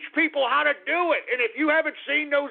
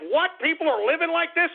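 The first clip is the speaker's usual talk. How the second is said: mock shock; 6 semitones higher than usual. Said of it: "and she's shocked".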